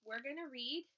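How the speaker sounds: ordinary delivery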